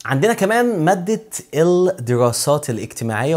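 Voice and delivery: ordinary delivery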